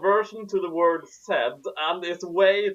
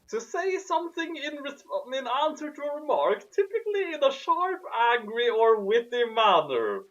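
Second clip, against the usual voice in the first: dictionary read-out; 7 semitones higher than usual. Real, not acted. Reading dictionary definition aloud.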